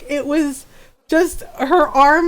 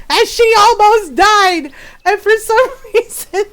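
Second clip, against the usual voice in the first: louder, higher words shaken by laughter; laughing; 6 semitones higher, 5 dB louder than usual. Real, not acted. Retelling the daughter's near fall, laughing loud. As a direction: burst out laughing on it.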